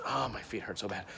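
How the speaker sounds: ordinary talk, nothing marked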